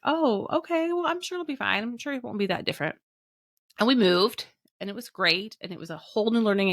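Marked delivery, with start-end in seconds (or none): 0.0-3.0 much higher, airy brush-off; naive breezy optimism. Retold or imitated. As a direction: chirp it off like nothing could go wrong. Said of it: "And I was like".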